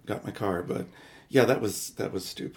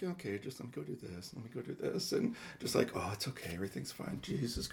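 much softer, muttered under the breath; muttered self-talk; 11 dB quieter than usual. Retold or imitated. Calming herself at the police station, muttered. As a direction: talk yourself through it quietly.

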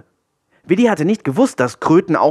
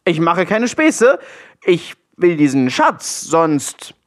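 slow, high-pitched mock threat; threatening; 5 semitones higher, slower than usual. A narrator's reading. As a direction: spell out a warning, word by slow word. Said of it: "fügte er noch ein paar bedrohlichere Sätze an".

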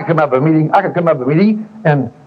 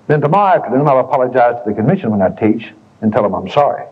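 usual speaking voice

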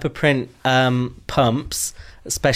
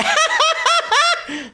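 high-pitched cackling laugh; Davina laugh; 3 dB louder than usual. Retold or imitated. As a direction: cackle up high like a gleeful diva. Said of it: "That's my, um, Davina laugh".

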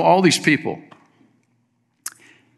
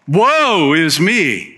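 slow, mocking woe-is-me whine; mock self-pity; 5 semitones higher, 3 dB louder, much slower than usual. Preacher mocking Elijah's pity party, slow mock whine. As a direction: drag it out like a sulking prophet. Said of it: "He's repeating himself".

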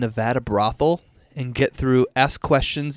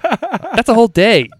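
laughing; 8 semitones higher, 5 dB louder than usual. Real, not acted. Laughing at the dare.

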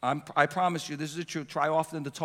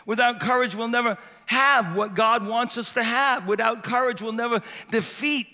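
rising preacher's cadence, pressing each line; building sermon refrain; 6 semitones higher, 3 dB louder than usual. Real, not acted. Preacher pounds the refrain to his church, building. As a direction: drive the refrain home to the congregation.

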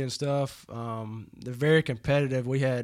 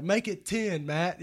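higher called-out challenge; mock yell; 6 semitones higher, slower than usual. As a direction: throw it across the table at Matt. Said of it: "So I, I yell it out".

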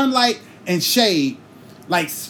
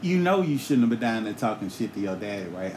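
lower, softer knowing taunt; low sly tease; 9 semitones lower, 6 dB quieter than usual. Retold or imitated. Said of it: "Tiffany say".

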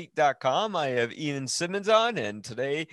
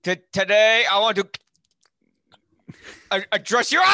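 loud, higher mock speech with stuttered starts; mock stammering king; 7 semitones higher, 8 dB louder, faster than usual. Host playing the king mid-speech as Leatherface breaks in, mock stammering oration. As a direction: play a stammering orator shouting out his address. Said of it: "imagine the middle of the speech".

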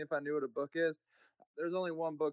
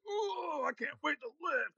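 high, gushing gym-bro voice, pitched well up; mock meathead excitement, high; 12 semitones higher, slower than usual. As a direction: play an eager muscle-head who can't wait to lift. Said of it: "The meatheads came in and were like".

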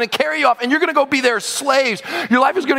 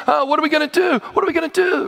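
higher, fretful worry; mock panic; 6 semitones higher, faster than usual. Besieged listener wondering what to do, acted panic. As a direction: fret helplessly, pitch climbing. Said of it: "Now I'm scared".

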